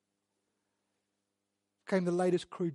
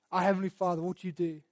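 normal talking voice

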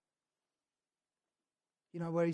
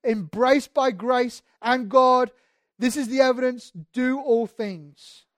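louder, higher passionate sermon; fervent preaching; 6 semitones higher, 10 dB louder than usual. Acted. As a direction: preach it out, full conviction. Preaching at full force.

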